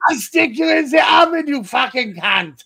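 high, loud screaming insult; swearing tirade. Acted. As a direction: scream the threat, laughing. Dr. Ruth fighting Judge Judy.

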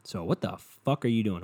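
ordinary talk, nothing marked

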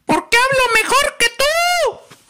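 squawking parrot voice, extremely high. Retold or imitated. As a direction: screech it like a parrot. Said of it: "conteste el cotorro". (voz de perico chillona, altísima)

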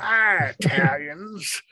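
put-on slow drawn-out old prospector voice. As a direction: stretch it out like a grizzled gold miner. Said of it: "saying it like an old prospector".

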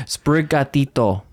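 Sprigatito said the Spanish way, tapped r, pure vowels, spri-ga-TI-to; Spanish pronunciation. Shown as a demonstration. Cohost showing a friend how to say Sprigatito in Spanish. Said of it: "That's how you pronounce it".

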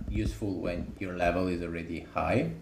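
ordinary delivery

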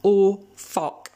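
a retold scared curse; startled oh fuck. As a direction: blurt it out in alarm. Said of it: "And we're like".

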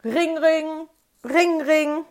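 high, loud telephone ring imitation. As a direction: mimic an old phone ringing, loud and high. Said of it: "Jetzt bimmelte es aber".

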